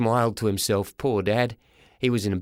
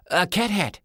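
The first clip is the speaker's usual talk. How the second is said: put-on boy's voice; 7 semitones higher than usual. Boy answers his father.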